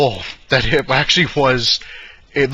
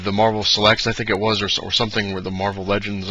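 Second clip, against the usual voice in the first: ordinary delivery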